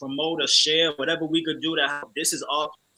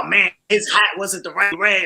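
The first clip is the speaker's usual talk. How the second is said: hammed-up fan complaint, pitched higher and louder; mock nitpicking viewer; 4 semitones higher, 7 dB louder than usual. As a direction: play a picky viewer griping about details. Voicing picky viewers' complaints.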